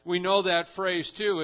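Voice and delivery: ordinary delivery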